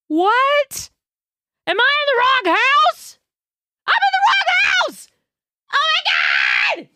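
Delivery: high panicked yelling into the phone; panicked scream. Retold or imitated. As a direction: shriek it as you realise you're in the wrong house. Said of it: "I start screaming in the phone".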